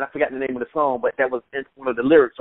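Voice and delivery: normal talking voice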